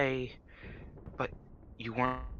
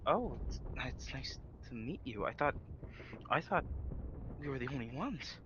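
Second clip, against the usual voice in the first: much softer, hesitant greeting; surprised villager; 9 dB quieter than usual. Acted. Villager greeting strangers softly, amazed they're not alone. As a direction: say it quietly, half amazed. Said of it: "they say".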